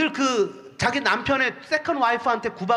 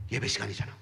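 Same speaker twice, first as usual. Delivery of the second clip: much lower and softer, matter-of-fact; a hint of a stubborn friend, low and soft; friend's low reply; 8 dB quieter, faster than usual. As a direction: say it low and quiet, brushing off the nosebleed. Friend's reply, nose plugged.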